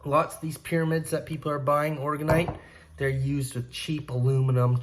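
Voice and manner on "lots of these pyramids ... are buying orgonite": usual speaking voice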